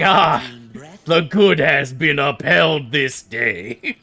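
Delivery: slow, grand, self-satisfied proclaiming; pompous paladin. Retold or imitated. Paladin proclaims righteousness after a kill, slow and pompous. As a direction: savor the victory like a smug knight. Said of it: "And I'll just be like".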